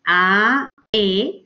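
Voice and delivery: "A, E" as two detached syllables; separated vowels. Shown as a demonstration. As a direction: give "A" and "E" each its own beat.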